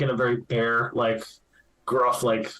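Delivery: ordinary delivery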